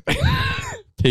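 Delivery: high, slow call across the deck; summoning PK from afar. Retold or imitated. Friend calling out to PK.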